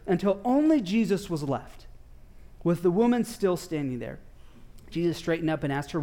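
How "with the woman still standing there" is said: ordinary delivery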